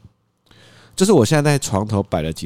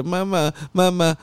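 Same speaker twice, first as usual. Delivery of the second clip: much higher, slow whine; imitating toddler; 9 semitones higher, slower than usual. 模仿孩子. (高音慢速模仿小孩)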